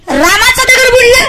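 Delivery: preacher feigning shock at the crowd's answer; mock disbelief. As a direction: act stunned the stone sank. Preacher mocks crowd's wrong answer.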